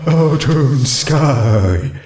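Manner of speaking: drawn-out shy ghost. Acted. Ghost mare giving her name, slow and shy.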